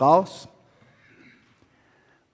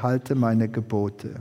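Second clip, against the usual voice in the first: lower, slow, solemn divine command; a low, slow voice from heaven; voice of God; 8 semitones lower, 4 dB quieter, slower than usual. Retold or imitated. Voice from heaven telling the woman to keep the commandments, low and solemn.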